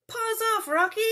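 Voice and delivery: high, loud scolding of the pet; shout at the cat. Acted. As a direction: yell at the cat to back off.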